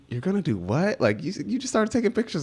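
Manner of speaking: high incredulous dad voice; surprised dad. Retold or imitated. Dad voiced in retold story.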